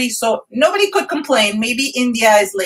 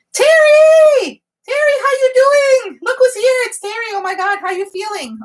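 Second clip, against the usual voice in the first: shrieking her name, much higher and louder; thrilled greeting; 13 semitones higher, 5 dB louder than usual. Real, not acted. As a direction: greet a dear friend you feared for. Regular viewer returns after procedure.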